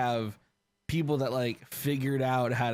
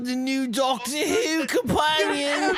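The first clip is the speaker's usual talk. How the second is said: higher, louder, slow drawn-out Cockney; mock Cockney accent; 11 semitones higher, 4 dB louder, much slower than usual. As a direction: stretch it out in a hammy Cockney accent. Joking Cockney bit continues.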